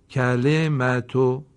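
slow, drawn-out blended word; slow blended reading. Shown as a demonstration. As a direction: join the sounds slowly into one word.

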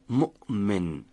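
مؤمن split by a clear glottal stop after the first syllable; hamza stop mid-word. Shown as a demonstration. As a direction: shut off the voice at ؤ, then release into من in مؤمن. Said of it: "به این شکل". (مؤمن با مکث همزه و رها کردن ناگهانی)

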